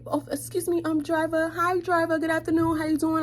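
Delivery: high, sugary mock politeness; over-sweet to get his attention; super polite. Calling sweetly to the ignoring bus driver. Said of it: "super polite".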